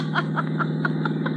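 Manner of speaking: breathless delighted giggle; giddy laugh. Acted. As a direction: laugh like she's having a great time. Woman laughing in the car.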